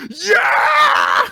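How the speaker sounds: huge high-pitched cheering yell; crowd cheer. Retold or imitated. Host imitating people excited for performers, screamed cheer.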